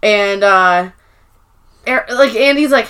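ordinary talk, nothing marked

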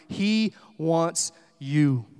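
loud, slow, spaced-out proclamation; emphatic. Real, not acted. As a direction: land each word slowly and forcefully. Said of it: "answer this as emphatically as I can".

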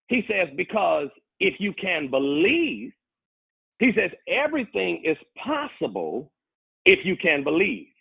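deliberate preaching replay. Real, not acted. Preacher rewinding for those who missed it, deliberate and emphatic. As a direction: deliver it phrase by phrase, high and emphatic. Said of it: "Let me rewind it".

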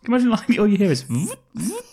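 picture it and giggle; excited joke. Acted. Joking to co-host about latex suits, high and excited.

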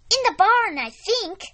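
high and loud eager. Acted. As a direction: blurt it out eagerly. Eager daughter answers.

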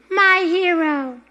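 high slow swooning damsel. Acted. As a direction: sigh it like a rescued heroine. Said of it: "This is a hair bow".

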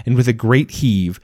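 ordinary delivery

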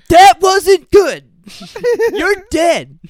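hammed-up yelled threat; mock threatening yell. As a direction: bark it slowly as a laughing menace. Acting out the threatening reply.

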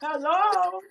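high, loud hype shout to the riders; rallying the group. Retold or imitated. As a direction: whip the crowd into racing mode. Amping everyone up at track.

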